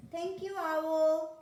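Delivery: loud, high, drawn-out thank-you; delighted thanks. Acted. Little monkey thanks Owl for the eyes, delighted and loud. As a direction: sing out the thanks, slowly and loudly.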